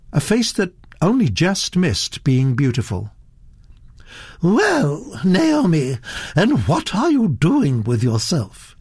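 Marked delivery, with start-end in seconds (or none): 4.4-8.8 put-on higher, imperious old lady gossiping; the Duchess holding forth to Mister Satterthwaite, imperious; haughty old Duchess. Grande dame talking down her cousin's girl, haughty voice.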